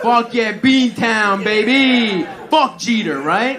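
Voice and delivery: loud, high whooping hype; hammed-up hometown cheer; mock Boston cheer. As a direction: pump up the Boston crowd. Crowd work, Boston cheer.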